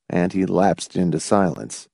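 usual speaking voice

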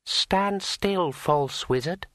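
high, otherworldly dryad queen; commanding tree spirit voice. A narrator's reading. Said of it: "said Drueli".

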